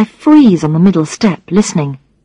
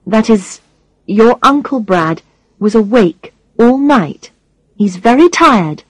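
ordinary delivery

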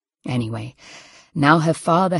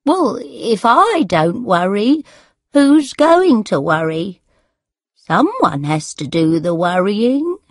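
put-on worrying elderly mother; 3 semitones higher, 4 dB louder than usual. A narrator's reading. Mother answers daughter who told her not to worry, fretful.

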